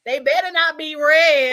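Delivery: warning from another room. Retold or imitated. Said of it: "here go to Danielle from the other room".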